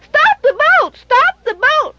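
put-on high, urgent child's cry; a small boy begging the captain to stop; crying little boy. A narrator's reading. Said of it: "he cried".

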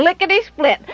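ordinary delivery

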